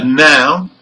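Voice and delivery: usual speaking voice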